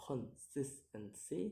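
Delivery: "consistency" split into syllables; separated syllables on "consistency". Shown as a demonstration. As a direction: sound out "consistency" piece by piece. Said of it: "count the word syllable backwards".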